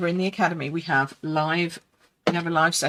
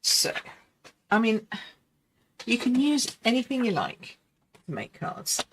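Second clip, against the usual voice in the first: ordinary delivery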